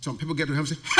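ordinary talk

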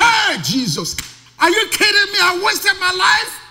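high shouting protest, slowing at the end; anguished outcry. Pretend. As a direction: cry out in disbelief at a wasted life. Voicing a regretful soul in heaven.

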